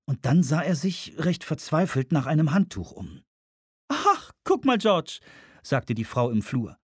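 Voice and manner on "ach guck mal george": hoch gesetzte Frauenstimme; Frauenfigur. Acted. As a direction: spiel eine Frau in hoher Lage. Woman voiced in narration. (hohe Frauenstimme)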